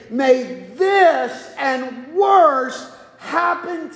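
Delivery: very slow, weighty vow; drawn-out sacred pledge; solemn slow oath. Acted. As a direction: stretch every word of the oath. God's oath, slowed.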